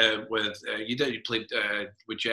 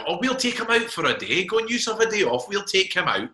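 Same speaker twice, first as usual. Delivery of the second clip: higher, lightly voiced aunts, cheerfully offering; imitating the aunts; 8 semitones higher than usual. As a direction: play the eager aunts offering a day out, pitched up. Dad's sisters voiced offering outing.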